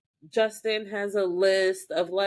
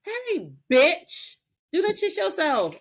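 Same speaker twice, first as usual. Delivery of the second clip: sassy Piper; 11 semitones higher than usual. Retold or imitated. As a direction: snap back with attitude. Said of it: "Piper goes".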